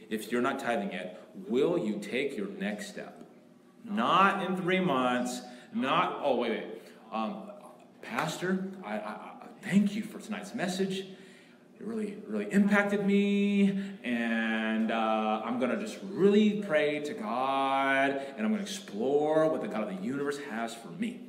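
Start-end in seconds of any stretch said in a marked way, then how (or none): 3.8-6.2 forceful, slow and loud; emphatic. Real, not acted. Pastor urging tithing now. 6.2-21.2 mock hesitant congregant. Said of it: "Pastor, I have a question".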